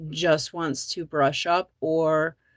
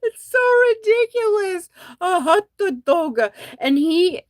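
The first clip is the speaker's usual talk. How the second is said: high-pitched laughter breaking through the words, much louder; can barely get the words out for laughing; laughing hard; 7 dB louder than usual. Real, not acted. Cracking up at the old man's joke, laughing through the words.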